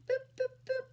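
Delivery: playful high boop sounds. Acted. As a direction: squeak out little boops. Teacher counting on fingers.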